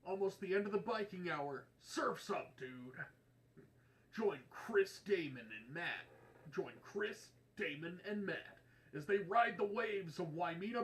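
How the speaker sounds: loud, high, hyped sports promo. Acted. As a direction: sell it like a blaring extreme sports trailer. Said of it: "Promo voice?".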